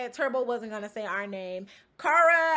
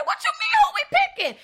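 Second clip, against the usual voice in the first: much higher, louder retold yell, cracking into a laugh; incredulous shout; 5 dB louder, much faster than usual. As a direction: shriek it like you can't believe your ears. Retold outburst at partner.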